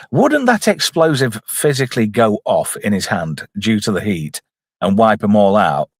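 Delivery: usual speaking voice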